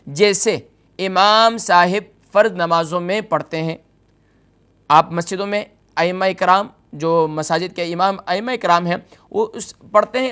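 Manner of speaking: ordinary talk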